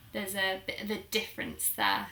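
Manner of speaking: normal talking voice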